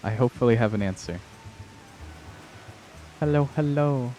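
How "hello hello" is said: high, sing-song hello; chirpy welcome to a viewer; cute anime streamer. Real, not acted. Greeting a chatter.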